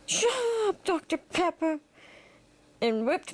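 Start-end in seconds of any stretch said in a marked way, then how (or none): 0.1-1.8 high-pitched dismissive character voice; Great One. Acted. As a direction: cut them off in a high voice. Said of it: "And he said".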